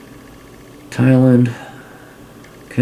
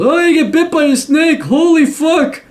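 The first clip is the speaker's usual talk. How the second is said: much higher, squeaky mock panic; mock panicked tourist; 4 dB louder than usual. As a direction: squeal it like a clueless tourist spooked by snakes. Mocking a naive tourist's panic.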